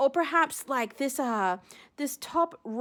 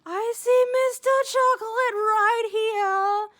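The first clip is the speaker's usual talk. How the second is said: higher, louder and slow with delight; thrilled discovery; 7 semitones higher, 4 dB louder, slower than usual. Real, not acted. Spotting the chocolate cone she wished for, thrilled and slow. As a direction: savour finding the prize shell.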